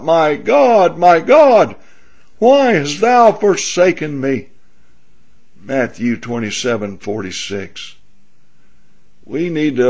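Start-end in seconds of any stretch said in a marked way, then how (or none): 0.0-4.5 Jesus crying out. Acted. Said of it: "Jesus cried out".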